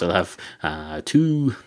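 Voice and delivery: ordinary talk, nothing marked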